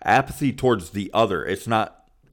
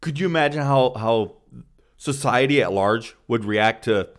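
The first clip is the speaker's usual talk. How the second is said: ordinary delivery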